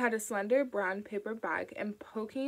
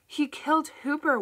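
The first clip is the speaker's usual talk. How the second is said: higher, louder urgent plea; pleading mother; 6 semitones higher, 6 dB louder than usual. A narrator's reading. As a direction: press him hard to listen.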